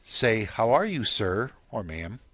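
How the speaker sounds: ordinary delivery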